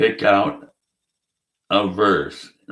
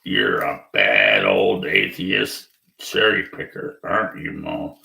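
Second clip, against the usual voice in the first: much lower, mocking scolding voice; mock scolding friend; 6 semitones lower than usual. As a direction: ham up a believer chiding the atheist. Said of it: "You only pick out the bad stuff".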